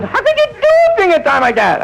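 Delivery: high shocked nurse voice. Retold or imitated. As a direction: squeal it like a scandalised nurse. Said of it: "She goes".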